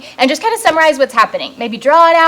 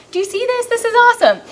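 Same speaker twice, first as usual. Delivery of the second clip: much higher, thrilled exclamation; delighted exclaim; 10 semitones higher, faster than usual. Real, not acted. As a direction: gush with delight at the twist. Delighted reaction to the Pharisees.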